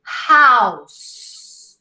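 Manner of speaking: House with a long, lingering s; double S. Shown as a demonstration. Teacher models House with a doubled final S. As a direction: hold the s at the end of House. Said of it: "imagine you pronounce a double S".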